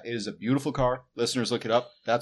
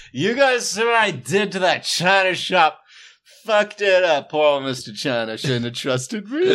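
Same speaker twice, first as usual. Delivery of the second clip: put-on loud, higher drunk bragging; 6 semitones higher, 8 dB louder than usual. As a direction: brag like a sloppy drunk. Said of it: "drunk you in the car".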